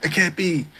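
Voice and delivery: high, hushed disbelief; small high-pitched voice of shock; mock flipping out. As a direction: imitate a lady in disbelief, softly. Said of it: "the lady, she flips out".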